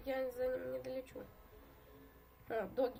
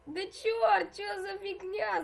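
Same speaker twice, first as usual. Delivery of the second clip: frustrated shout; 4 semitones higher, 6 dB louder, much slower than usual. Real, not acted. Gets squeezed and eaten.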